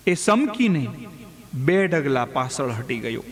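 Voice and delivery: plain reading voice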